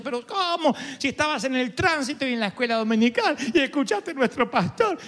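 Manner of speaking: shocked mother. Acted. Mother shocked at son's confession.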